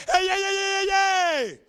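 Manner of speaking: high, loud repeated hey shouts; hammed-up shouting. Comic hamming up defiance, loud high shouts. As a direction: yell it like a rowdy crowd.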